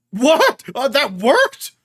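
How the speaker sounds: can't believe it; high loud astonished question; shocked. Real, not acted. Shocked at the scene.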